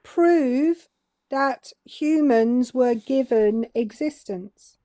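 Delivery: slowed down for someone who missed it; slow, emphatic demand; slow, deliberate repeat. Real, not acted. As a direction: spell it out slowly, pausing after "Prove". Repeats slowly after "What's that?.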